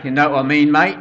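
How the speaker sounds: loud mock-Cockney banter; Cockney accent. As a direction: put on a matey Cockney accent. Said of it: "You know, the Cockney accent".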